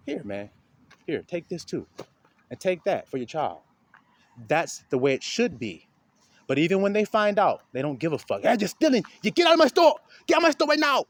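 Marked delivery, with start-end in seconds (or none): 0.1-3.6 softer, gentle offering; a kind brother giving to a hungry man. Acted. Kind man gives to thief. 8.4-11.1 higher, louder furious yell; a shop owner yelling at a hungry thief; angry shopkeeper. Acted. Store owner throws out thief.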